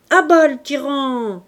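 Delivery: loud mock-revolutionary cry; rebel cry. A narrator's reading. Fameuil heckling Tholomyès, mock rebel shout. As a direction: shout it like a barricade slogan.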